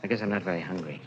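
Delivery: low, quiet and glum; subdued. Acted. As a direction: mumble it, downcast. Subdued after incident.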